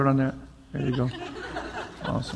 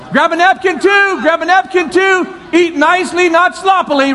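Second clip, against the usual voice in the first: calling out to a crowd; 4 semitones higher than usual. Real, not acted. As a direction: summon everyone up to the front.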